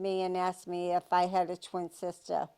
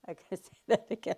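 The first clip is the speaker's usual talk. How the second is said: laughing; 3 dB quieter, much faster than usual. Real, not acted. Laughs at the tongue twister.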